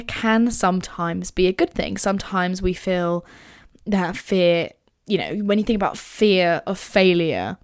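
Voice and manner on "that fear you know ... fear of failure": ordinary delivery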